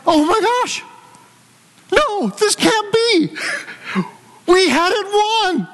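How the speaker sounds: high, slow, crying disbelief; anguished disbelief. Retold or imitated. Preacher reliving the lost Super Bowl, high anguished wail.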